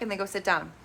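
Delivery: ordinary delivery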